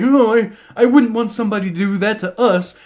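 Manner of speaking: high, loud whiny mock voice; a hint of a whining coach. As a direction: sneer at the apology in a put-on voice. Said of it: "That's like".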